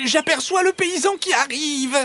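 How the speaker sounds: very high, urgent warning; high-pitched anxious alert; alarmed fox. A narrator's reading. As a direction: warn in a squeaky fox voice. Fox warns of approaching peasant.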